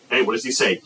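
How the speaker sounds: ordinary delivery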